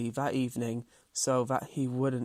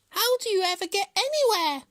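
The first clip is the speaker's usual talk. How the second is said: very high, loud hare voice; high-pitched, scoffing hare; mocking hare; 5 dB louder than usual. A narrator's reading. Hare needles Tortoise about his pace, high and mocking. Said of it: "Hare laughed".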